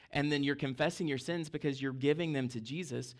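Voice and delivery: ordinary talk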